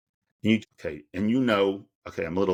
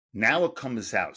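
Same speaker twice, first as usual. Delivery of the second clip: ordinary delivery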